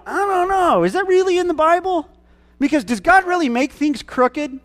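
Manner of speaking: high, skeptical mock doubter voice; doubting churchgoer. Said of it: "Some of us are sitting there going".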